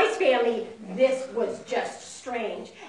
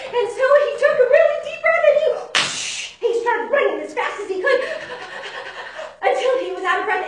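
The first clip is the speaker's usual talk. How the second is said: high, loud, racing narration; breathless rush; 10 semitones higher, 8 dB louder, faster than usual. A narrator's reading. Storyteller acts out the mouse's dash, rushed and breathless. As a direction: tell it fast and high, as if running.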